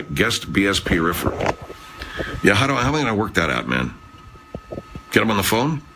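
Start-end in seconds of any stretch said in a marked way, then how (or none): none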